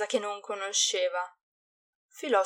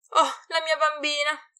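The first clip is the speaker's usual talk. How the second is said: high, louder joyful cry; joyful shout; 7 semitones higher, 4 dB louder than usual. Acted. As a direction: call out to the girl you missed. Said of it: "urlò la domestica".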